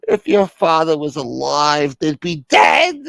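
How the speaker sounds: high, loud wailing threat; hammed-up grieving indignation; melodramatic outrage. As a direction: cry it out with mock fury. Wailing caller threatens on phone.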